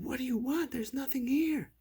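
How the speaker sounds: put-on high-pitched, distressed kid voice; frightened protest. A narrator's reading. Storyteller as brothers to ghost at closet, frightened protest. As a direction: protest high and pleading, as a scared child.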